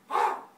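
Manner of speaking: sudden, very loud bird caw; raven caw. Acted. Raven calling down to a boy, loud caw. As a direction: caw like a raven on a street lamp. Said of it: "that's a great sound".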